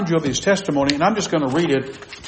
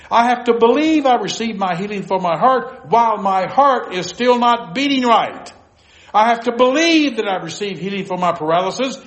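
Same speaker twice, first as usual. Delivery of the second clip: high-pitched, fervent cry of sudden realization; retold exclamation; 6 semitones higher than usual. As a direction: exclaim it to the Lord as a revelation, retelling it. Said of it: "I exclaimed".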